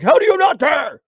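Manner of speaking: mock outraged yell, high and loud; imitated scream. Host mimicking co-host who cares too much, mock scream. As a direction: imitate a worked-up fan yelling in disbelief. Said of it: "you, like, scream at me".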